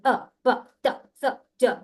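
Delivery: jerky loud letters, pushed out; fast and loud. Shown as a demonstration. Teacher showing the wrong way, fast and loud. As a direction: hammer each letter loud and quick. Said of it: "जल्दी भी पढ़ूंगी और जोर जोर से पढ़ूंगी".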